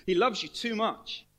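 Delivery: normal talking voice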